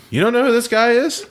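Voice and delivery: high-pitched, astonished retold question; incredulous question. Friend reacting to the narrator's no, incredulous and high. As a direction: ask it in utter disbelief.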